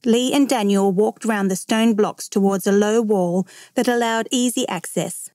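usual speaking voice

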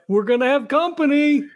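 high-pitched call-out, moderate volume; yelled warning. Acted. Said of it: "He just yells out".

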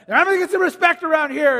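hammed-up furious father; Hulk dad. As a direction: demand respect like an exploding father. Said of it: "the Hulk dad".

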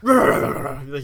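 loud mock dog growl; angry dog growl. Co-host mocking Batman's yelling, angry dog growl. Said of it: "my impression of an angry dog".

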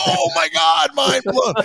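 high shouted mock wonder; mock amazement. Pitching a fake ghost show, high mock shout. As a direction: play the fake-excited host. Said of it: "It's go".

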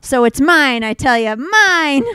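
loud, hammed-up claim; mock possessive outburst. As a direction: build to a high cry on the last word. Acted circus performer claiming credit.

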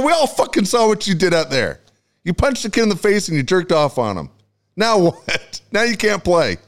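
high-pitched exasperated rant; retold angry berating. Dad to ejected son, retold angry berating.

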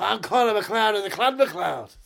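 loud, high, slow Scottish declaration; Scottish accent. Acted. Auditioning to redo Highlander, proud Scottish accent. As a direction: proclaim it grandly in a Scottish accent. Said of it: "redo Highlander with the proper accent".

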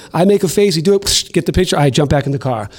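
ordinary delivery

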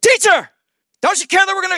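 high, loud mock panic; acted panicked cry. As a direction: cry out for help as if drowning, hammed-up. Acting out drowning disciples' panicked plea.

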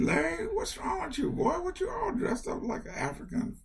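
high, scolding mother, pitched well up; mother asking her son what's wrong with him, in a high scolding voice; mother's voice. Retold or imitated. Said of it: "And she said".

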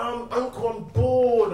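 high-pitched complaining nephew; mock frustrated kid voice; frustrated child. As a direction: imitate a bored kid pestering, pitched well up. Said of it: "he's usually quite frustrated".

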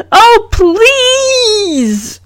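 very high, drawn-out begging whine; pleading call-out. A narrator's reading. Margot calls out to the teacher, pleading. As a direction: beg to be picked, stretching the last word. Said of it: "Margot calls out".

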